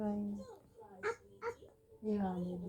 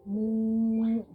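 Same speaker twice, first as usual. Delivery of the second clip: long, louder moo; cow mooing; 3 semitones higher, 6 dB louder than usual. Shown as a demonstration. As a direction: imitate a cow with one sustained moo. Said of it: "How about the cow".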